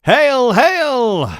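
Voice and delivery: very high, loud, sneering jeer; slow, loud mock salute; mocking soldiers. A narrator's reading. Soldiers jeering at the beaten prisoner, loud mockery. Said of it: "they mocked".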